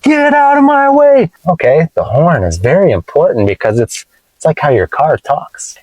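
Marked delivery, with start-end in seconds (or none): 0.0-1.3 much higher, louder mock angry yell; mad driver. Angry driver yelling at a car in the way, mock angry yell. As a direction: yell it like a furious driver stuck in traffic. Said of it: "You can use it when you're mad".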